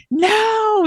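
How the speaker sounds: very high, drawn-out protest; losing it. Retold or imitated. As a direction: wail it out in mock despair, way up high. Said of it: "I just lose it".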